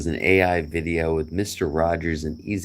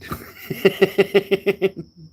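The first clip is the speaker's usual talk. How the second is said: high, real laughter; hearty laugh; 4 dB louder than usual. Real, not acted. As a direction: laugh out at the punchline. Laughing at the punchline.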